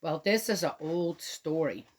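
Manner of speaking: ordinary delivery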